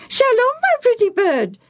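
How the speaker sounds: very high parrot squawk. A narrator's reading. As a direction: mimic like an eager bird. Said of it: "the parrot repeated".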